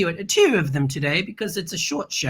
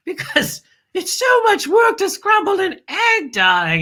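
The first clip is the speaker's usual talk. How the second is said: sarcastic shout; 11 semitones higher than usual. Acted. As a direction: shout the fake hardship sarcastically. Sarcasm about egg scrambler.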